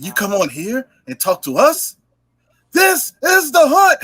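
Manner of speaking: high, slow hammed-up war cry; Sparta line. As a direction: bellow it like a Spartan. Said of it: "He said".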